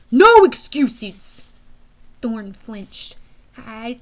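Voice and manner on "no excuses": very loud, slow, higher shout; booming reprimand. A narrator's reading. General silencing Thorne's protest, booming anger. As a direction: thunder down any protest.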